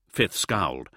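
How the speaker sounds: plain reading voice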